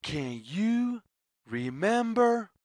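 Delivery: usual speaking voice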